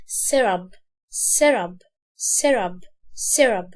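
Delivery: سيرب as "serab", short first vowel, falling pitch; light short vowel. Shown as a demonstration. Teacher drilling for learners, short light vowel in سيرب. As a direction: drill سيرب low and firm without a long "ee". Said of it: "مش هتكسر هنا قوي".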